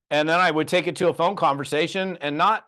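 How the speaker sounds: usual speaking voice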